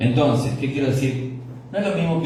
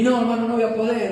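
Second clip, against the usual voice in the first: mock excuse; 9 semitones higher than usual. Excuse from a lazy believer.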